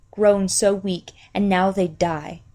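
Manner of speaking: ordinary delivery, nothing marked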